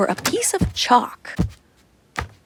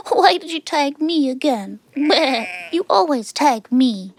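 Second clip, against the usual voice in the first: youngest goat shouting; 4 semitones higher than usual. A narrator's reading. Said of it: "shouted the youngest goat".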